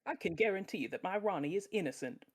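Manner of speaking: character voice. Acted. S2 voicing Desiree.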